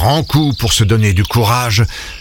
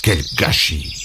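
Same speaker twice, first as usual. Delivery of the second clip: low, soft grumble; Léon turning away, muttering; grumbling mutter; 5 semitones lower, 6 dB quieter, slower than usual. Acted. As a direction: mutter it under your breath. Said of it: "en marmonnant".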